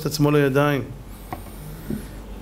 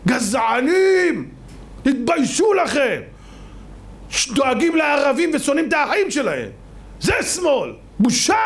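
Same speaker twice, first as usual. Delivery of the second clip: indignant denunciation; 6 semitones higher than usual. Real, not acted. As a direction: cry shame on them from the pulpit. Preacher denounces opponents to crowd. (תוכחה זועמת בצעקה)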